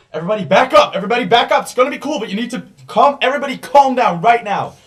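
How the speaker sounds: loud, high panicked shout to the crowd; shouting, high and loud, holding a hostage; Ironmouth shouting. Acted. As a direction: bellow it to make everyone back off. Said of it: "the voice of Ironmouth".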